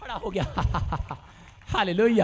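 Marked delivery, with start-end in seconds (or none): 0.5-1.1 low chuckle. Real, not acted. Chuckles after his anecdote.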